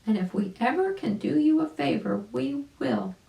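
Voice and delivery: high, light mother bird voice; a lilting bird mother, thanking; mama bird voice. A narrator's reading. Mama bird thanks Uncle Wiggily, in a high lilting voice. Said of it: "said the mama bird".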